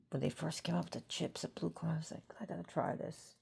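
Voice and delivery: soft conspiratorial whisper. Retold or imitated. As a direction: whisper it like a fond secret. Retelling eager memory softly.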